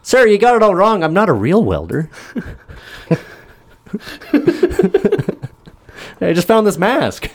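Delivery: put-on shrieking little boy protesting; squeaky kid. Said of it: "And the kid's like".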